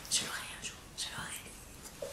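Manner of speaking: hushed, breathless whispered vow; mock whisper. Vlogger whispering to viewers, mock-determined whisper. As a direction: whisper it like a secret promise. Vows to get the dress.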